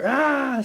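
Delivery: high, loud mock scream; a short hammed-up yell of excitement; imitated scream. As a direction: mimic his excited shriek. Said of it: "screaming outside".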